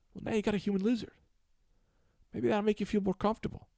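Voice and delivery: high, strained little guy voice; squeaky sheepish lizard-man confessing; [name]'s caller voice. Acted.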